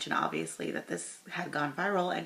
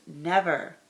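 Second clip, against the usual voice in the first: "never" punched louder like capitals; all-caps stress; 6 dB louder than usual. Retold or imitated. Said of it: "and then she capitalizes".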